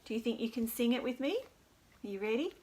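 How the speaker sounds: usual speaking voice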